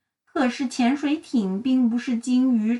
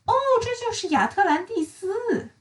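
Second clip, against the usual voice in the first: high, excited little voice; excited cry; 9 semitones higher than usual. Acted. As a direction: exclaim the discovery. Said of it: "古怪小姐大叫道".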